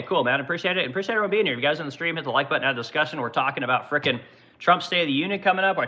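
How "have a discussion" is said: ordinary talk, nothing marked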